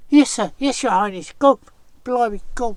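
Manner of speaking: loud, high, hurried yes-sirs; mock scared villager. Fake villagers obeying Anansi in a fright, loud and high. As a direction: scramble away in hammed-up fright. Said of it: "made lots of noise like".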